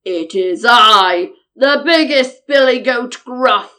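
big ugly hoarse billy goat; slow, booming biggest goat; loud, hoarse goat. A narrator's reading. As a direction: announce yourself in a loud, rough voice. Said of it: "had a loud, ugly, hoarse voice".

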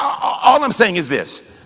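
ordinary talk

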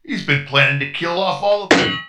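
put-on light New York detective accent; tough-guy accusation; gangster accent. Detective accusing Norm to the crew, light New York accent. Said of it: "talking in a strange accent".

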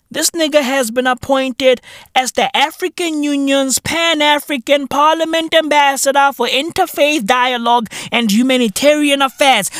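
normal talking voice

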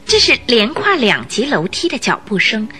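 normal talking voice

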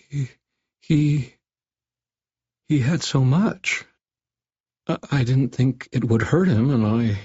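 cringing. A narrator's reading. Cornered Doctor Fabio explains himself to Harry, cringing. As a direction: stammer it out while shrinking back. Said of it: "he cringed back from me as he spoke".

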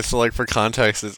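ordinary talk, nothing marked

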